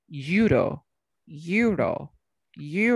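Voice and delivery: "Euro" as YOO-ro, stressed first syllable, falling; demonstrated pronunciation. Shown as a demonstration. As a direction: repeat "euro" as separate, emphatic YOO-ro. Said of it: "escuchen la pronunciación de euro".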